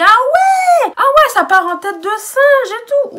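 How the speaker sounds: high, loud indignant cry; indignant outburst. Retold or imitated. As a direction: shout back in outrage. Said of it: "Je dis". (indignation criée, aiguë et forte)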